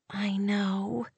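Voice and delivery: soft, voiceless admission of defeat; hushed, beaten whisper. A narrator's reading. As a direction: whisper it as if giving up. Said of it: "whispered in a tone of defeat".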